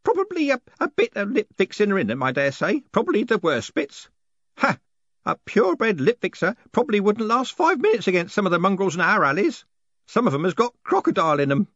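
put-on high-pitched old postman; old postman Groat.

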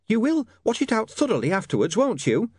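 high, prim nagging landlady; shrill nagging landlady. Acted.

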